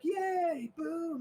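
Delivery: high little yay then boo; imitating the crowd's reaction; mock crowd cheer. Game master imitating the crowd, mock high cheer. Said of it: "their excitement, like".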